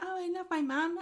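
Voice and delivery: high put-on girlish voice; lightly voiced sweet-talking girl; imitating a cloying daughter; mock Betsy. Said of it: "And Betsy's like".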